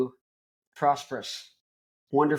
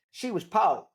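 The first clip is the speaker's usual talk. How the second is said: higher, lightly put-on Southern accent; Southern grandma; 6 semitones higher, slower than usual. Grandson voicing his Missouri grandma, Southern accent. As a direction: lean into a little Missouri twang.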